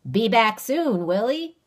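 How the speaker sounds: loud, probing backwoods question; sly stranger. Acted. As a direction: press him with a grin. Stranger presses.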